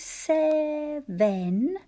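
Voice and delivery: "seven" drawn out slowly in two beats; syllable by syllable. Shown as a demonstration. Teacher practising the base of seventh, "seven" slowly in two syllables. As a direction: sound out "seven" as sev, en. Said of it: "So we practice".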